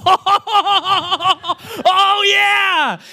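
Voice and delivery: high, loud, laughing whoop; laughing, high and loud "Oh, yeah"; excited shout. Retold or imitated. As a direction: whoop with joy at seeing the son. Father voiced seeing his son.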